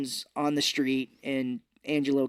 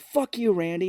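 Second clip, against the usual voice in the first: retold insult; 5 semitones higher, 3 dB louder than usual. Retells quarrel between characters.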